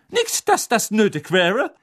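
high, snide aside; catty afterthought. A narrator's reading. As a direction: add a dig about her looks. Rita adds a catty afterthought.

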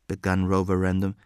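ordinary delivery, nothing marked

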